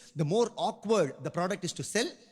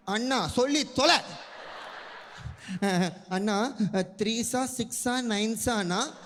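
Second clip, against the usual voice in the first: put-on girl assistant; 3 semitones higher than usual. Shop girl asking loudly.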